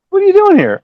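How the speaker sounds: high, loud baffled question; retold surprise. Puzzled exchange in the driveway at rehearsal, surprised. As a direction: imitate someone thrown by an unexpected visitor.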